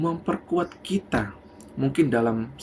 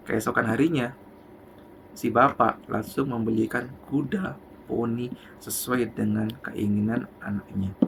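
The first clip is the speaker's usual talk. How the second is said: ordinary delivery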